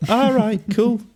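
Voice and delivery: high, nervous brush-off; high awkward voice. Pretend. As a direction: back away nervously, high. Pretend awkward farewell to Joe.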